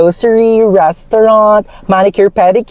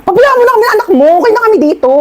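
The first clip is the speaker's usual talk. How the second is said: mock scolding; 11 semitones higher, much faster than usual. Scolding the mother on phone.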